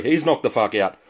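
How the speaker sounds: normal talking voice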